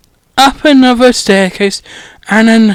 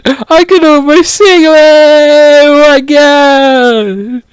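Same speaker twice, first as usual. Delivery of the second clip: higher, gleeful and stretched out; giddy; 5 semitones higher, 3 dB louder, slower than usual. Real, not acted. The reader gloats over Malfoy's detention, gleeful. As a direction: revel in it.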